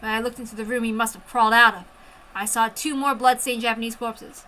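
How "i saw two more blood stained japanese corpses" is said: plain reading voice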